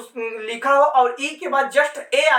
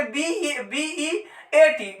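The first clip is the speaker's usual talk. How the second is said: spelling out letters; 3 dB quieter, much slower than usual. Shown as a demonstration. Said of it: "beat में देखेंगे".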